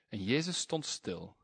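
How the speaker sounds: ordinary talk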